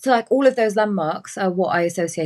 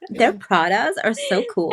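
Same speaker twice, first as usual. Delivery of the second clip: put-on slow, airy valley girl drawl; 4 semitones higher, slower than usual. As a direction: gush over Pradas. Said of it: "like a valley girl".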